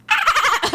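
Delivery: high squeaky attempt at a witch cackle; failed witch cackle. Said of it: "Try to sound like a witch?".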